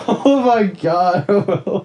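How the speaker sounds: breaking into helpless laughter; laughing. Real, not acted. Friend laughing, tearing up.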